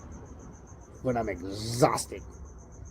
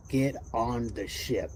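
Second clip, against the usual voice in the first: slow, lightly voiced ominous quote; a hint of a solemn warning, slowed down; 3 semitones lower, 4 dB quieter, slower than usual. Quoting an online source to viewers, slow and solemn.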